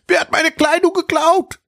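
put-on high, loud, outraged boy's cry; loud shout. A narrator's reading. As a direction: yell it like a boy robbed of his clothes. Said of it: "rief Friedrich laut".

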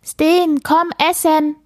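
high, loud motherly call. A narrator's reading. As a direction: call the child to dinner from downstairs. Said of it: "rief Mama die Dachbodenleiter hoch".

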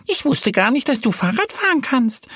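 high-pitched duck voice; sceptical little duck. A narrator's reading. Duck doubts her bear friend, sceptical. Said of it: "Annie runzelt die Stirn".

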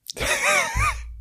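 helpless squealing laugh; high squeaky giggle. Real, not acted. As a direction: burst of high-pitched laughter. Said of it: "dieses Thema finde ich jetzt schon so lustig".

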